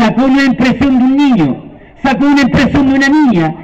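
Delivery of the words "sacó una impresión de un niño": plain reading voice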